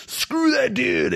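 high, slow mock outburst at another driver; mock road rage. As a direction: act out boiling over at a driver, half in jest. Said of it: "you just boil up and you're like".

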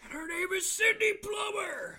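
very high, full-volume announcer parody; mock announcer shout. Co-host echoing the wrestling intro, high shouted announcer hype. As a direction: scream the name like a ring introduction.

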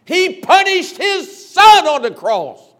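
slow, booming high proclamation; preacher's roar; thundering scripture. Acted. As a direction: proclaim it to the back row. Preacher quotes scripture with force.

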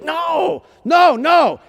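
high, loud drawn-out retold protest; really loud. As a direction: protest loudly, pitch shooting up. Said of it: "He's, like, really loud".

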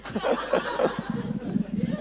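soft high laugh, no voice; high breathy laugh. Real, not acted. Preacher laughing at his own sweat, high breathy laugh. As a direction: laugh at yourself.

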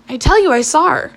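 insistent. Acted. Man to doubting companion, insistent. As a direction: insist against being doubted.

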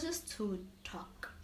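soft whisper. Real, not acted. Guest explaining the podcast idea in a whisper. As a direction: breathe it out. Unvoiced line.

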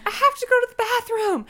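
high, loud, strained retold cry; hammed-up panicked wail; urgent labor yell. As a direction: re-enact a desperate shout.

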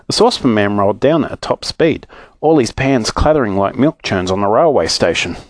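usual speaking voice

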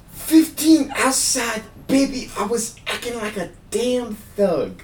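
very high [name] voice, slow and drawn out. Shown as a demonstration. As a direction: spell it out slowly for a confused listener. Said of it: "You can always slow it down".

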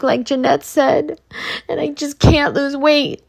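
high-pitched, frustrated whine about the diet; whiny friend. Retold or imitated. Venting to her best friend, high frustrated whine. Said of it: "best friend is just like".

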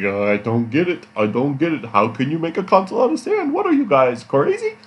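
rising, squeaky New Jersey impression of Bobby; mock New Jersey accent. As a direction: ham up a Jersey guy who doesn't get it. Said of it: "That's pretty much his New Jersey accent right there".

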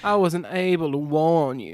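put-on high Southern belle accent; hammed-up Southern belle lament; Blanche Devereaux. Co-host as helpless Southern belle, apologising. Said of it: "Okay, Blanche Devereaux".

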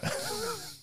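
amused chuckle after own joke; short burst of laughter. Real, not acted. Referee amused at wanting a replay, laughing.

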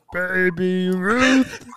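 very slow, dragged sloth voice. Retold or imitated. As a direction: drawl it like a dopey sloth. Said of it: "It's a really good sloth voice".

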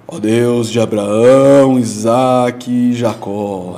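put-on slow, loud, drawn-out old-fashioned prayer; oração à moda antiga. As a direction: imitate an elder switching into solemn prayer, stretching each name. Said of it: "ele até dá uma subidinha assim". (imitando oração antiga, solene e arrastada)

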